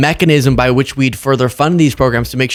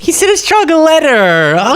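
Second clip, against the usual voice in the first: high, stretched-out mocking squeal; drawn-out, high-pitched ridicule; mock excitement; 3 dB louder than usual. Real, not acted. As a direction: jeer it with over-the-top delight. Mocking Schumer's weak letter.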